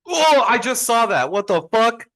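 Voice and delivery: real high, very loud shout of disbelief; shocked outburst. Real, not acted. Podcaster reacting to Nick Saban retiring, shocked outburst. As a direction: yell it out, voice shooting right up. Stunned by breaking retirement news.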